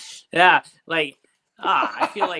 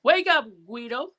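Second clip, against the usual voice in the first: Guido talk; 8 semitones higher than usual. Retold or imitated. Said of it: "talking like Guidos".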